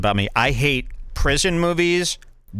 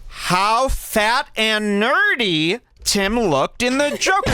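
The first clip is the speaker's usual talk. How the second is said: gleeful mockery; 8 semitones higher, slower than usual. Real, not acted. Gleeful roast of Tim Dillon.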